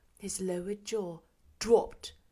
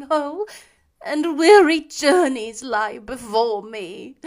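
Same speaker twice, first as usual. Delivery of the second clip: mournful ghost; 4 semitones higher, 13 dB louder than usual. A narrator's reading. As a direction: lament like a chained spirit doomed to wander. Ghost speaks to Scrooge.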